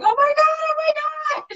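high squealing mock scream; imitating a screaming fan. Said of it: "screaming, going".

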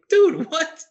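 incredulous. Retold or imitated. Retelling his reaction to a Jets fan friend, incredulous. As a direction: squeak out retold shock at his friend.

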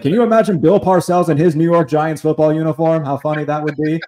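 loud, high-pitched comic's rant; George Carlin. Retold or imitated. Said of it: "he's like".

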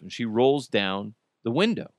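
plain reading voice

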